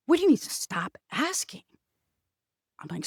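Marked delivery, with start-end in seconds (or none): 0.1-1.6 incredulous. Retold or imitated. Coworker shocked by the advice.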